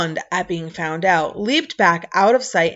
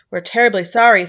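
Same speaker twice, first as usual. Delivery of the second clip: ordinary delivery, nothing marked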